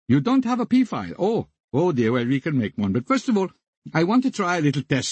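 over-the-top mock Indian accent, polite phone-clerk; comic Indian accent. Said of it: "talking in a very cod, over-the-top, comic Indian accent".

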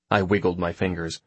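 usual speaking voice

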